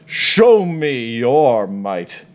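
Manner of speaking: drawn-out, stately taunt; measured god's challenge; slow grand challenge. Acted. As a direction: dare them slowly and grandly. Fitander's first challenge.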